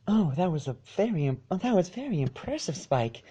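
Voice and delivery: soft, a touch shaky and fast; faint tremor. A narrator's reading. Fluttershy praises Spike with the faintest tremor. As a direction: hide the fright, barely trembling. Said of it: "the faintest tremor in her voice".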